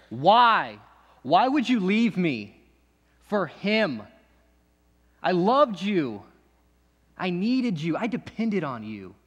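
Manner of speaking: calls out to the one who left; anguished cry. Acted. Crying out at absent fiance.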